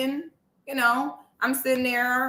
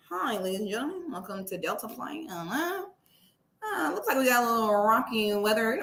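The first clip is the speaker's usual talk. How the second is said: pilot announcement voice; 5 semitones lower, 6 dB quieter than usual. Retold or imitated. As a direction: imitate a bored captain on the intercom. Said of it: "Why do pilots all talk like that?".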